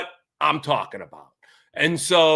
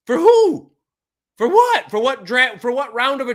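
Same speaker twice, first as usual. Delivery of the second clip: exasperated disbelief; 7 semitones higher than usual. Real, not acted. Exasperated rhetorical questions.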